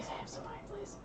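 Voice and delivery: whispered request. Real, not acted. Quietly asking for wine.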